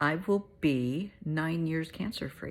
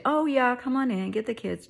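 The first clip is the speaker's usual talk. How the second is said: high, loud mock-friendly host; mock welcome; 8 semitones higher, 6 dB louder than usual. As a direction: play the absurdly cheery doorman. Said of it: "they open the door and say".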